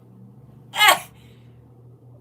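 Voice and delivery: a high, loud burst of laughter. Real, not acted.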